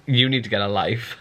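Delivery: usual speaking voice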